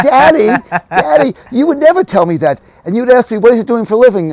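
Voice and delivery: high, loud mock outrage; protesting daughter. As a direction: imitate a scandalized daughter pleading with dad. Acts daughter's protest to dad.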